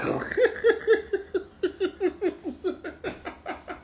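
high, disbelieving burst of laughter; incredulous laugh. Real, not acted. Laughs at the intentional walk.

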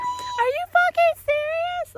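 very high, loud, drawn-out squeal; slow, piercingly high re-enactment of disbelief; high-pitched voice. Retold or imitated. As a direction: imitate your own shocked squeal.

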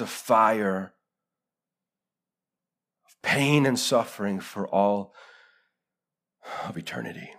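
very low and soft, long pauses; hushed, grave preaching. Acted. Preacher warns we get one shot at this, hushed and grave. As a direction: let it sink almost to nothing, solemnly.

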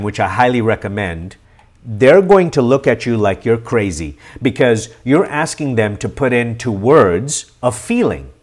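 ordinary talk

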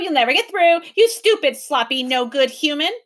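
mock the professor's words; mocking creature. A narrator's reading.

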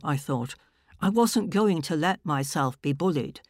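plain reading voice

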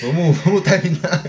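fala entre risos, mais alto; rindo. Real, not acted. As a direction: encerre rindo sem parar. Rindo após constrangimento. (falando e rindo)

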